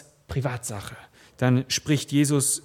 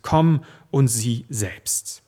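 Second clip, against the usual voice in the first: slow, weighty quote; 4 semitones lower, much slower than usual. Acted. Preacher quoting the invitation.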